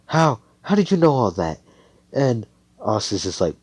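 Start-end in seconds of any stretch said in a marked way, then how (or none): 0.1-1.6 higher, louder disbelieving question; a touch of startled demand; mildly startled. Acted. Girl asks how he knew all that, mildly startled. Character startled by being seen through.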